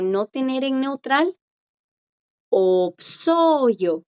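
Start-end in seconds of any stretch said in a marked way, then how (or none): 2.5-4.0 opsoyo slow, with a clearly voiced-out S; drawn-out teacher's opsoyo, the S pronounced; sounded S. Shown as a demonstration. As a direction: model opsoyo syllable by syllable, S heard before the vowel. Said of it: "Ahora la S sí suena".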